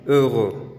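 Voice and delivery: "Heureux" rounded front vowels; French heureux. Shown as a demonstration. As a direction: repeat "Heureux" clearly. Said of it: "Esta es la pronunciación".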